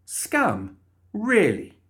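rising to a high, incredulous question; offended disbelief. Acted. Molly repeating the guard's insult, offended. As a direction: echo it back, affronted, then leap high.

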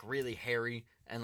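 ordinary talk

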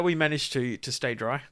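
usual speaking voice

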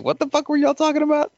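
high, fast mock outrage; baffled listener. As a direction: fire it off like a baffled fan.

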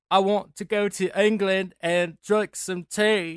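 slow, high, loud mock-British put-on accent. Mimicking her sister's bad British accent over drinks. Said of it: "they'll start speaking in a British accent".

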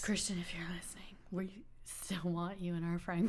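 hushed, voiceless aside; whispered plea. Pretend. Podcaster pleads to a listening contestant, whispering. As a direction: whisper it as a playful secret message.

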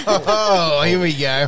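laughing loudly while speaking; words spilling out through laughter. Real, not acted. Laughing at German banter.

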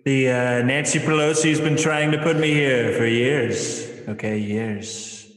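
put-on raspy old president rambling; Joe Biden impression. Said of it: "Joe Biden in a cave".

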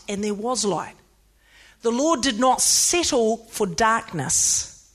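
usual speaking voice

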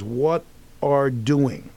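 very slow, deliberate reading; script read word by word. Acted. Actor reading Silvio's faulty fax line aloud, word by word. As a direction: spell out each word of the line slowly. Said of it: "Silvio walks up and says".

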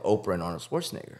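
normal talking voice

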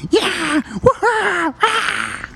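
high whooping cheer; happy whooping. Acted. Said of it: "whooping, like".

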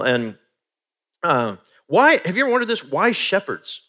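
ordinary delivery

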